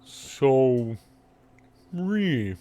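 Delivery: slow, drawn-out cow voice; lowing cow with long pauses between words; talking cow. Acted. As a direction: ask it ponderously, vowels stretched like mooing. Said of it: "Henry looks back around and looks down and says".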